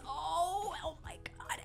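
hushed, voiceless amazement; awed whisper. Real, not acted. Fan finding pins in the box, awed whisper. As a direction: whisper it, overwhelmed by the gift.

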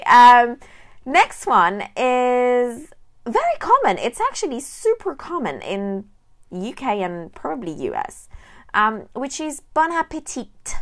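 usual speaking voice

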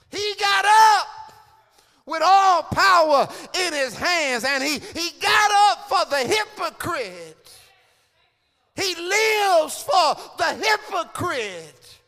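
loud, high, building shout; climactic preaching. Acted. Preacher celebrating the resurrection to the congregation, climactic shouting. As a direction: whoop the resurrection to the rafters.

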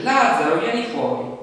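a reading voice lifting into a cry to Lazzaro. A narrator's reading. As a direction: call the dead man out. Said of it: "gridò ad alta voce".

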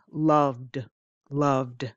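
loved with a clear D ending. Shown as a demonstration. Teacher models the D ending to learners, clearly. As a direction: end loved on a voiced D. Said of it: "Do you hear the D?".

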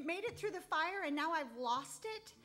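high, exasperated cry of disbelief; retelling her dismay at losing the dowel; upset disbelief. Retold or imitated. Upset disbelief over the lost dowel. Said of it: "I was so upset because I thought".

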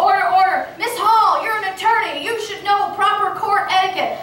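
high, loud stern chairman gaveling; a hint of a gavel-banging chairman; chairman calling order. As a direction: scold her like a chairman with a gavel. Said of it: "banging the gavel".

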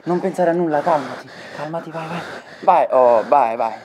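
soothing. Acted. Friend talking him through panic.

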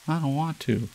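reluctant refusal. A narrator's reading. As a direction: refuse with a child's stubbornness. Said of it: "he said again".

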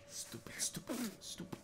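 whispered stupid, stupid, stupid, scolding himself; self-scolding whisper. Real, not acted. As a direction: hiss it at yourself after a blunder. Self-scolding after a mistake.

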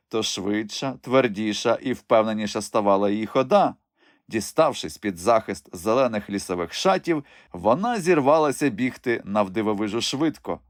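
ordinary delivery

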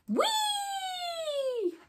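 gleeful whee. Acted. Bunny kids skidding in.

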